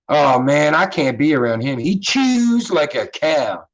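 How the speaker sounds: "chews" dragged out, Baltimore style; Baltimore accent. Shown as a demonstration. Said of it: "That's that Baltimore accent for sure".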